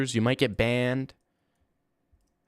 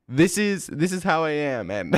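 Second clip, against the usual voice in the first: higher-pitched, hammed-up self-defence; mock proud declaration; 3 semitones higher than usual. Young man answering whoever asks about it, mock proud declaration. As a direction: play at proudly owning it. Voicing a suggested proud reply.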